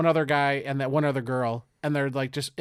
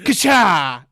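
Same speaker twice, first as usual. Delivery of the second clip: punch sound effect; 3 semitones higher, 7 dB louder than usual. Retold or imitated.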